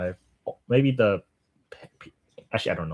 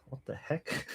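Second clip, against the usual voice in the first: breathy unvoiced whisper to himself; whispered what the heck; 15 dB quieter, slower than usual. Real, not acted. Streamer stumbling over Russian whispers to himself in disbelief. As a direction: whisper it under your breath, baffled. Fumbling Russian tongue twister.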